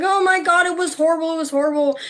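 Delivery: high, loud tearful wail; crying. Retold or imitated. Crying to Glenn on the phone about the birthday. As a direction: sob it out over the phone. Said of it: "busted out crying to Glenn on the phone".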